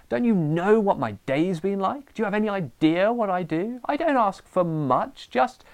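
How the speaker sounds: high-pitched, exasperated tirade, slowing on the last lines; ranting parent. Pretend. Tired parent ranting at fighting children at night, high exasperated rant. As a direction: let the tired parent boil over at the kids. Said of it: "you burst into your children's room and say".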